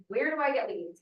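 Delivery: normal talking voice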